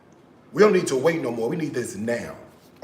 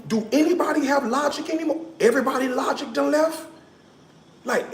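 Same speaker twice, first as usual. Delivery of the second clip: high-pitched mock lament; 9 semitones higher than usual. Mock lament over lost common sense.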